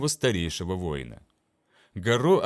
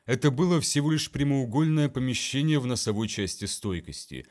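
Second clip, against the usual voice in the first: plain reading voice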